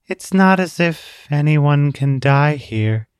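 put-on slow, even, tired girl's voice; weary monotone. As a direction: say it as if nothing could matter. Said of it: "Her voice sounded almost monotone".

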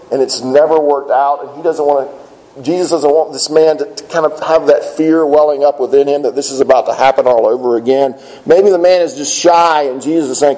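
usual speaking voice

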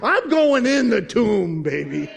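loud, slow, high-pitched defiance; bold declaration. Acted. Believer answering doubters, a bold drawn-out shout. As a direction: belt it out, stretching the words.

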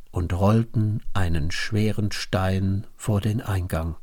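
plain reading voice

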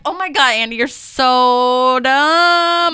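hammed-up exasperated swoon; exasperated fangirl. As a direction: groan it in loving exasperation. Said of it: "And I'm like".

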